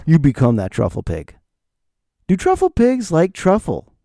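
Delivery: normal talking voice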